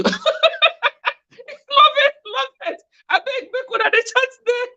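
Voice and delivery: high, laughing through the words. Real, not acted. Host laughing at the viewers chatting among themselves. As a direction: giggle it out, pleading playfully.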